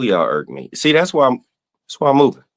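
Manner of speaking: ordinary delivery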